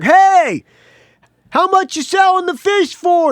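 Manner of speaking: high, loud call across the water; hailing the captain from the dock; yelling from the dock. Retold or imitated. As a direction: shout it over to the boat. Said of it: "I'm yelling at him from the dock".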